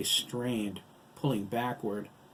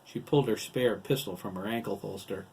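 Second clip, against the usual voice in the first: usual speaking voice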